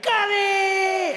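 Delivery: high held yell of outrage; boy's exasperated scream; top-of-lungs scream. Acted. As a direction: hold it loud and high. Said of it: "scream at the top of his lungs".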